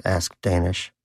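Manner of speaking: ordinary delivery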